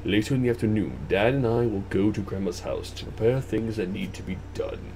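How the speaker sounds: story narration. Acted. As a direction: read the backstory softly, trailing into near-whisper. Reads game's opening story.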